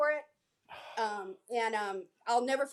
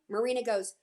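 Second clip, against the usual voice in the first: ordinary talk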